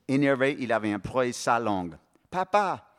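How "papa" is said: « Papa! » lancé beaucoup plus haut; choquée, comme un rappel à l'ordre; cri choqué. Acted. Marie choquée par l'insulte. (la fille scandalisée par le mot de son père)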